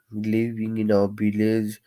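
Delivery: normal talking voice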